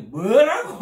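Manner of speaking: furious patient turning on the preacher, loud; enraged retort. Retold or imitated. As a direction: flare up at the insult. Man enraged, about to hit.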